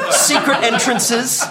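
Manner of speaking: ordinary delivery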